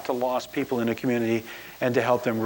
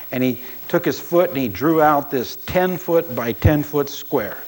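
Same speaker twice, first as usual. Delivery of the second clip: ordinary talk, nothing marked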